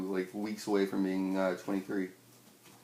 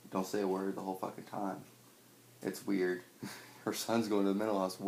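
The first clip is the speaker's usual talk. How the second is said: ordinary delivery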